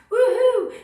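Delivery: loud, high woohoo; excited whoop. Real, not acted. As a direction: whoop to fire up the crowd. Hyping up the live audience.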